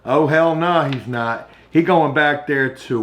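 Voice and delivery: ordinary delivery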